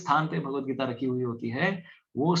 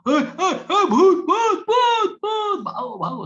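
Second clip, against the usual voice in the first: much higher, louder terrified shrieks of ghost; panicked scream; 8 dB louder, much slower than usual. Retold or imitated. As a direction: scream as if you saw a ghost. (भूत देखकर डरी हुई ऊँची चीख)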